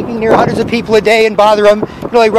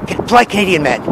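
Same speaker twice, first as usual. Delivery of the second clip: low, gravelly superhero parody; mock Batman growl, kept soft; Polite Canadian Man; 3 semitones lower, 4 dB quieter, faster than usual. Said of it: "keep your voice low and gravelly".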